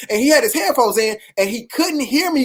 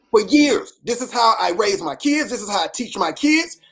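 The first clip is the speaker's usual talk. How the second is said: usual speaking voice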